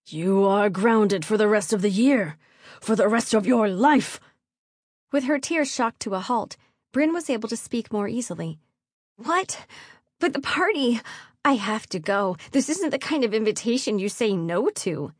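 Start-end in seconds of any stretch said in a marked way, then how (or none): 0.1-4.2 loud, emphatic angry father; furious yell. A narrator's reading. Baron grounding his teenage daughter, furious yell. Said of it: "the Baron yelled". 9.2-15.1 put-on protesting teenage daughter. A narrator's reading. Daughter to her father about the party, shocked protest.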